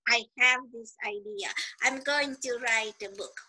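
a lightly voiced dreamer mulling over a book idea; mock would-be writer. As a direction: softly parody someone who never starts. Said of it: "they have this idea".

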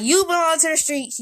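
animated retold clapback; retold taunt. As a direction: throw the insult right back. Retold insult thrown back.

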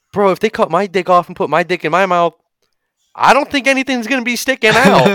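high, incredulous dude voice; worried bro prisoner. Acted. Prisoner reacts to the guard's threat, high and worried.

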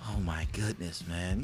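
low, soft, weary groan of words; exasperated mutter. Retold or imitated. Husband sighs to himself over his wife, low and weary. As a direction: give up in quiet despair.

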